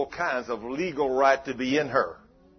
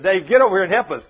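louder, fast urgent call across the room; 3 semitones higher, 8 dB louder, faster than usual. Retold or imitated. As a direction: summon a passerby in a hurry. Calling Dave over in restaurant.

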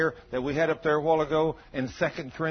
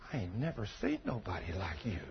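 much softer, lower, in wonder; hushed awe; 6 semitones lower, 14 dB quieter than usual. Retold or imitated. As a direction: let it fall almost to a whisper. Quiet retelling of amazed man.

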